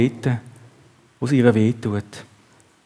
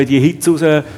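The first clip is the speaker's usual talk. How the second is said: usual speaking voice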